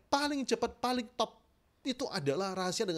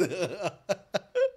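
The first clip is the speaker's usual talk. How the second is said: short laugh. Real, not acted. Laughs at own demo.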